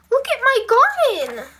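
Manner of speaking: loud, high exasperation; high-pitched loud protest; crying out. A narrator's reading. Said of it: "cried Maggie".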